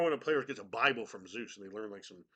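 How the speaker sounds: ordinary delivery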